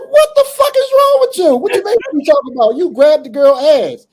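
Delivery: put-on high, loud furious yelling at the kid; retold furious yell at a pervert student; angry yell. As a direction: chew him out at full volume. Yelling at the boy in school.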